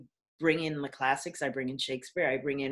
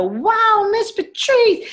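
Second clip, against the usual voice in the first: put-on excited kids; 9 dB louder, slower than usual. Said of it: "they go".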